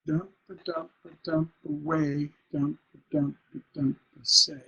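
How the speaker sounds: rhythmic chant landing on a stressed "away" and "say"; masculine ending. Shown as a demonstration. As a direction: beat out "dump, a dump", ending firmly on "say". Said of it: "stress was on the last syllable".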